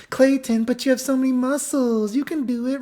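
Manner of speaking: put-on very high, girly coaxing; imitated fawning girls. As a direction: coax in a squeaky put-on voice.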